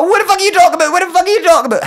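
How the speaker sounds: loud, high-pitched outraged demand; acted-out squealing row; arguing. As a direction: shriek it in exasperation. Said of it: "Having a pure argument".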